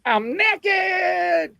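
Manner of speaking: high, panicked mock squeal; high embarrassed squeak. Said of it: "And I was like".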